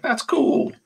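very high faux-hillbilly approval; Robert Kirkman. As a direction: imitate an easily impressed fake hillbilly, way up high. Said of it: "a pretty good Kirkman that I'm doing".

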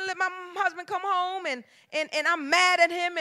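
ordinary talk, nothing marked

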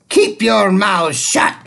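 Miss Swamp denies story hour; witchy teacher. Acted. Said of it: "said Miss Swamp".

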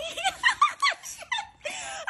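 giggling from the cold; high shocked laugh. Real, not acted. Laughing after plunge.

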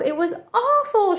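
high-pitched mild wail; acted distress with a high swoop; storybook wail. A narrator's reading. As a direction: wail it like an upset child, at normal volume. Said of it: "she wailed again".

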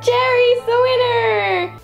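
high, drawn-out winner announcement; mock announcer. Referee declaring the thumb-war winner, high and drawn-out. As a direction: stretch it out high like a victory call.

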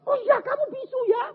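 high, astonished exclamation; husband's sudden realisation. Retold or imitated. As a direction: imitate a husband just catching on. Suami baru sadar, jemaat tertawa. (suami terperanjat baru sadar)

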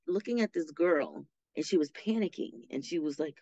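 ordinary delivery

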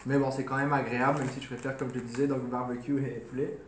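eating snacks while speaking; talking while chewing. Real, not acted. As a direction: mouth partly full of crisps. Seen 0: Holds snack at mouth, bites at 0.6-0.8, keeps talking.

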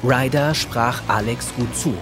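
normal talking voice

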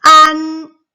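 high, loud 안 called out; loud model syllable. Shown as a demonstration. Teacher modelling 안 loudly for kids. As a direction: belt out 안 for the class. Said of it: "칠 번".

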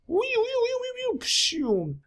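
very high, soft slowing squeal; cartoon electrocution noise; electrocuted squeal. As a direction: sizzle out as you fry. Monster electrocutes itself.

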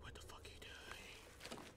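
slow, hushed whispered disbelief. Retold or imitated. Streamer voicing the character as a slow, hushed whisper. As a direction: whisper it slowly, like someone creeping.

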